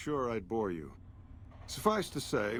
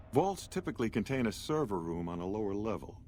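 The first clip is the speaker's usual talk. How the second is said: ordinary talk, nothing marked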